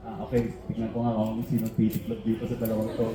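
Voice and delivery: soft, low aside to yourself; quiet inner thought. Retold or imitated. Watching two old men argue, quiet private thought. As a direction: mutter it to yourself.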